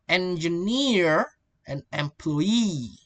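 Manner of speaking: "engineer", "employee" slow, last syllable drawn out; final stress. Shown as a demonstration. Teacher showing final stress, long last syllables in "engineer" and "employee". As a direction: stretch NEER and EE at the ends. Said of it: "stress on the last syllable".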